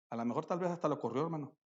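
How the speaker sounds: ordinary talk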